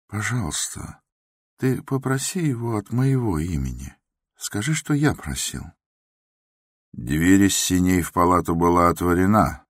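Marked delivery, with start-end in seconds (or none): 0.1-5.6 mild doctor. A narrator's reading. Doctor asks the watchman.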